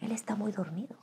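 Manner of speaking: hushed coaxing inner voice; whispered temptation. Retold or imitated. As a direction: lure her quietly to the door. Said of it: "mi carne me decía".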